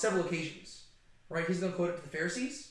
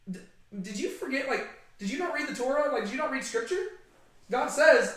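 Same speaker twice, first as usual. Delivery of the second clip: higher and louder, mock-incredulous jab; snarky Jesus; 7 semitones higher, 4 dB louder than usual. Jesus jabbing at the Pharisees, snarky and incredulous. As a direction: playfully needle them like a know-it-all. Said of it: "Jesus is actually pretty snarky".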